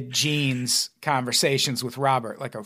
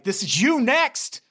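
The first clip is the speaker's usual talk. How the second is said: louder, higher menacing scold; mock threatening parent; 7 semitones higher, 3 dB louder than usual. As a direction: threaten the kid with mock fury. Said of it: "be like".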